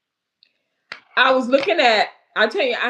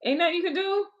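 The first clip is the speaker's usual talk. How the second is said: laughing plea; 11 semitones higher, faster than usual. Real, not acted. Pleading with guest, laughing.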